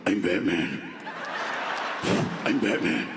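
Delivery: very low, gravelly-dark growl "I'm Batman"; creepy Batman. Retold or imitated. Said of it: "He even sounds creepy".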